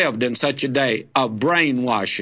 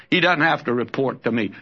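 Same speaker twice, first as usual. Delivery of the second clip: usual speaking voice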